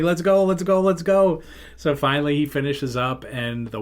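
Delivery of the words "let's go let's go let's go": high, loud, repeated urging; urgent hurrying. Retold or imitated. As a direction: hurry a friend along, pitched up and pushing. Pounding car, urging friend to leave.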